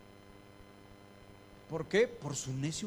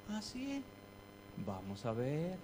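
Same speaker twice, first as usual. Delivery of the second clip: a soft divine warning; God answering; 2 semitones lower, 6 dB quieter, slower than usual. Acted. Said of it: "dice Dios".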